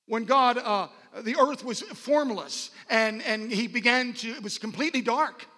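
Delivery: loud, high-pitched preacher proclamation; forceful preaching. Real, not acted. Preacher proclaiming the Son present at creation, forceful preaching. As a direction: proclaim it with rising conviction.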